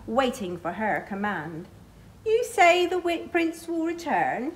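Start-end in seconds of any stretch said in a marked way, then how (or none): 2.3-4.6 higher, scornful witch sneer; mocking witch. A narrator's reading. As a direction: taunt the princess's hope. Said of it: "she cackles".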